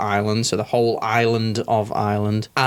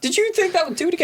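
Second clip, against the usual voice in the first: mock voice; faster than usual. Mocking impression in banter.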